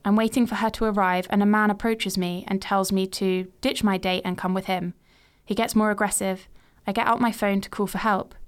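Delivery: ordinary delivery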